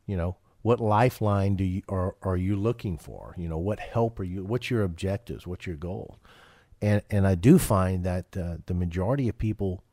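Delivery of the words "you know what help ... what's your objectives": ordinary talk, nothing marked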